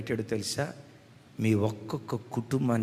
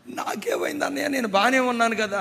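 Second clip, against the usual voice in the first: much higher, light younger-brother voice; 10 semitones higher, 4 dB louder than usual. Retold or imitated. Injured younger brother cheerfully reassuring his crying elder brother. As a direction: cheerfully brush off the worry. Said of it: "అన్నాడు".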